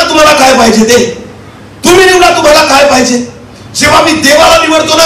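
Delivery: sustained declaiming sermon delivery; impassioned preaching. Acted. Preacher testifying about choosing Jesus over death, impassioned preaching. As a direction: testify in driven, ringing phrases.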